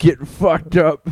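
mock party cheer. Imagined text about whiskey.

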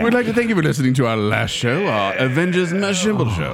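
lightly put-on British accent; bad British accent. [name] thanks listeners in a bad British accent. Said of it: "Save the bad accents for later".

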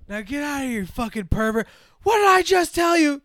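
hammed-up shout building in anger; mock angry shout. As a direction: throw him out, rising to a yell. Acting out the angry dismissal.

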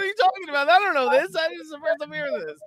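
high-pitched, baffled reaction; mock bewilderment. Laughingly retells his clueless reaction.

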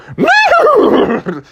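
very high yelp in the ear; high loud wake-up squeal; loud wake-up call. Acted. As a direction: blast a wake-up noise. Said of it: "go into his ear and go".

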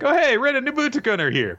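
high-pitched chirpy mock greeting; cheerful greeting. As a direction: squeal a sunny hello in a put-on high voice. Voices cheerful Ruru greeting.

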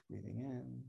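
tiny breath cue. Shown as a demonstration. Girl doing baby alligator breaths under her desk, very soft. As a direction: show how small and secret it is. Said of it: "she does baby alligator breaths under her desk".

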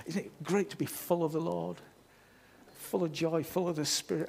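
breathy mock-enthusiast, lightly voiced; gushing churchgoer. Church member gushing to neighbours about being filled, mild parody. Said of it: "I don't know why I do that".

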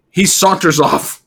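usual speaking voice

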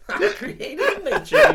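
high, slow laughing Southern put-on; cartoon southern. Joining the joke with a high, slow laughing Southern drawl. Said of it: "You go full cartoon".

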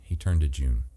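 ordinary delivery, nothing marked

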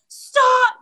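high, drawn-out mock protest; mock panicked plea; imitating alarmed husband. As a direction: act out a horrified husband pleading. Retold husband's horrified plea.

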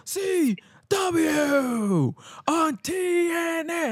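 high, stretched-out announcer hype; Joey Styles. Retold or imitated. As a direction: stretch every letter to fire up the crowd. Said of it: "hyping up the crowd saying".

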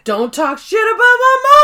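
high, loud indignant yell; pissed off. Acted. Jake defending his mama after a hit, angry yelling. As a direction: yell it in hammed-up outrage. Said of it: "Jake gets pissed off".

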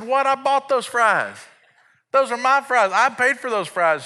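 high, incredulous dad outrage; indignant protest. Acted. Dad disputing who owns the fries, indignant high protest. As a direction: protest it high, stretching the claim.

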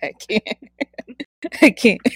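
giggling helplessly between words; can't stop laughing. Real, not acted. Overcome with giggles.

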